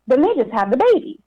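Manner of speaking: ordinary talk, nothing marked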